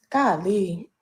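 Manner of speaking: ordinary delivery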